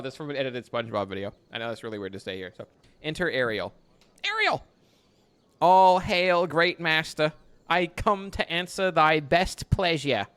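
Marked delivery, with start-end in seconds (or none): 4.3-4.6 high, ringing call of the name; a sudden high summoning cry. Acted. Calling the character in. 5.6-10.3 louder, grand stage delivery; hammed-up Shakespeare line reading; theatrical reading. Reading Ariel's lines aloud.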